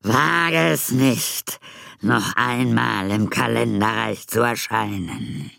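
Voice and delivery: slow, menacing villain voice; threatening voice. Acted. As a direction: threaten slowly from the shadows. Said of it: "mit drohender Stimme". (drohende Stimme des Unsichtbaren)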